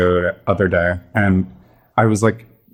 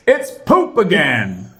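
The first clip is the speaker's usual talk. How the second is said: put-on high, slow old man voice; old guy yelp; 7 semitones higher, slower than usual. Old man who stepped in poop, high slow squeal. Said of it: "and he goes".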